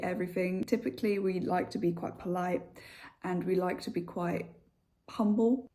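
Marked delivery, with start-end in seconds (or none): none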